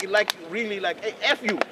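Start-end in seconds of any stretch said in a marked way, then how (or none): 1.0-1.6 higher, louder mock tirade; retold angry outburst. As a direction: blow up at the reporter. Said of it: "he flipped out".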